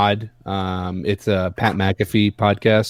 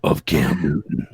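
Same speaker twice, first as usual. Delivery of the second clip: movie trailer voice. Shown as a demonstration. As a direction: build it like a film trailer. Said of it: "I can't do that".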